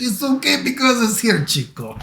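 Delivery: high, hammed-up Spanish accent. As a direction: exaggerate a mock Spanish accent. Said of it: "make him sound like he's Spanish".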